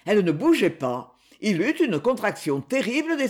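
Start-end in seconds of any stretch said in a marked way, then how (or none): none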